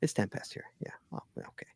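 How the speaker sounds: soft whisper, almost no voice; whispered aside. Real, not acted. Host to co-host, a whispered aside about the time. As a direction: slip it in under your breath.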